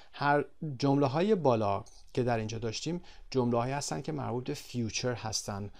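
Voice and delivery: ordinary delivery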